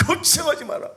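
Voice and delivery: 걱정하지 마라 shouted high and loud, 걱정하지 punched and falling; mock arrogant brag. Preacher mocks the proud disciples, loud cocky brag. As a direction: ham up the cocky boast. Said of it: "교만했잖아요".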